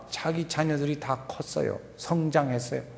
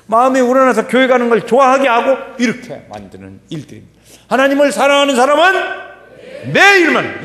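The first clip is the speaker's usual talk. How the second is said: loud, high-pitched sermon exhortation; impassioned preaching; 9 semitones higher, 10 dB louder than usual. Real, not acted. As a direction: drive it home to the congregation at full voice. Preaches at full force. (목청 높인 열띤 설교)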